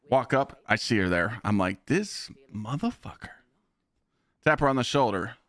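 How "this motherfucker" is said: muttered curse. Retold or imitated. Spots fiancée, muttered.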